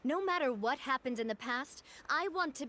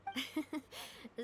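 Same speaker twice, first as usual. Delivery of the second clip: a quiet, amused little laugh; soft laugh; 7 dB quieter than usual. Acted. Navia laughing softly at Paimon's seaweed complaint.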